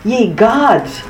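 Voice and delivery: high-pitched exclamation of alarm; startled cry. A narrator's reading. Startled opera-goer backing away from thunder, crying out. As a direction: cry out high, without much extra volume. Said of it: "and cried".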